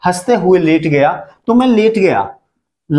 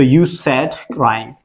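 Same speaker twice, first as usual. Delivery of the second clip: very slow, dropped lower; slow dictation voice; slow model answer; 5 semitones lower, much slower than usual. Shown as a demonstration. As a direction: spell it out for the students. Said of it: "teacher models English answer".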